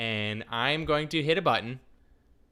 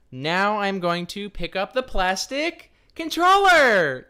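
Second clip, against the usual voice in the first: excited buildup; 6 semitones higher, 4 dB louder, slower than usual. Acted. Building up to game time.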